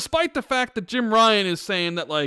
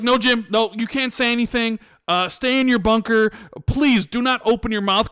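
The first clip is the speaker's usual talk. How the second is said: pleading loudly; 5 semitones higher, 5 dB louder than usual. Retold or imitated. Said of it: "they're like".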